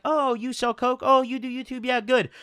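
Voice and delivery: high-pitched, chatty imitation of her; mock friendly woman. Imitating the woman's earlier friendly chat.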